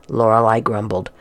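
usual speaking voice

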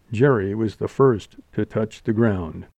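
usual speaking voice

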